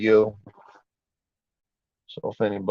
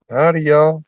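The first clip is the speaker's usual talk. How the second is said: bright, loud, higher howdy; cheery loud greeting; 6 semitones higher, 9 dB louder than usual. Real, not acted. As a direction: greet the crowd loudly. Greeting stream viewers.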